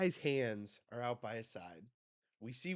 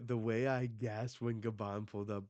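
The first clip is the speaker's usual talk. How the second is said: ordinary talk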